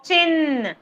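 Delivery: "Chin" said clearly with a crisp ch and held n; careful sound demo. Shown as a demonstration. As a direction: draw out "Chin" so the first sound is heard. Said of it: "Listen carefully".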